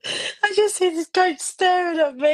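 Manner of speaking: high, tearful laughing voice; laughing till nearly crying; helpless giggles. Real, not acted. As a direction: squeeze the words out through laughter. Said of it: "Oh, we've lost her now".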